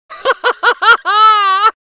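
high loud cackling laugh; gleeful cackle. A narrator's reading. Gingerbread boy laughing at chasers, high loud cackle. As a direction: cackle in triumph.

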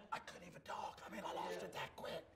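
voiceless, airy rasp; a croak with no voice left; voice gone. Shown as a demonstration. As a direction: say it as if your voice has given out. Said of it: "I lost my voice just like that".

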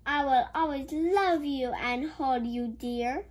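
ordinary delivery

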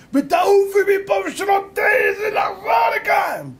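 very high, slow, drawn-out yell; acted landlady rage; furious banishing shout. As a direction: throw her out with a full-force scream. Landlady throws the girl out.

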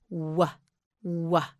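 "w" as a short rounded wuh; letter sound W. Shown as a demonstration. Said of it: "W makes the sound".

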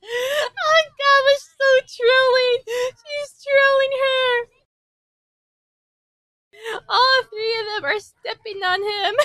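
high, loud talk through laughter; laughing excitedly. Real, not acted. Fan cracking up at the girls stepping on him, laughing. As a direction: laugh while you get the words out.

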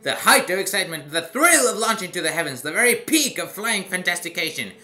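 lightly voiced clown barker hyping his ride; carnival barker voice. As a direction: sell the ride like a carnival huckster. Voices carnival ride owner Fear.

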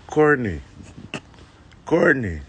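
mild call across the bar. Retold or imitated.

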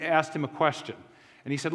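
normal talking voice

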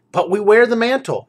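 a player character pleading their case; party member protest. Acted. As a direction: adventurer insisting to the guard. Party member answering the guard.

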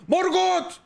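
hammed-up war challenge; challenging shout. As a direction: call the dark lord out to fight. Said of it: "Bu da gidiyor bağırıyor".

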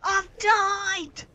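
high wail of defeat; dismayed shout. Real, not acted. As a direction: wail that you've lost. Train destroyed in game.

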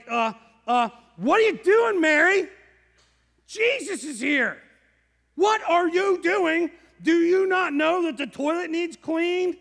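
high, loud frantic nagging; a flustered hostess scolding her sister; frantic Martha. Acted. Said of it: "So Martha is like".

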